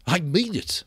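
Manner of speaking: usual speaking voice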